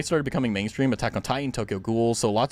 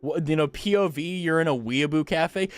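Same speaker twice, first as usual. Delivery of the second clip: ordinary talk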